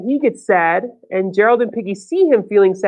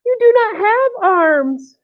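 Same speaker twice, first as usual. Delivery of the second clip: high, slow and deliberate; slow, high-pitched pronouncement to a friend; storybook character voice; 9 semitones higher, slower than usual. Acted. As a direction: draw each word out up high. Voicing Piggy in picture book.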